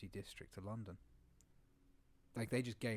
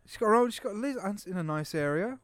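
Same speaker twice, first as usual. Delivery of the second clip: excited pitch; 8 semitones higher, 9 dB louder than usual. Real, not acted. Excitedly selling the single mum.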